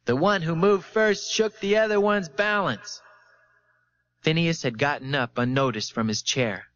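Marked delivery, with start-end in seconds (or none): 0.1-3.0 high-pitched, excited exclamation; exclaiming. A narrator's reading. Onlooker on the platform realising what happened, exclaiming. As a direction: burst out with it, pitched up throughout. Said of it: "Someone on the platform exclaimed".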